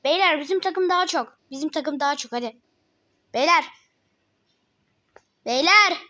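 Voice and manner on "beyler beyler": louder call to the team; shouting. Real, not acted. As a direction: call out to the guys. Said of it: "Ben niye bağırıyorum?".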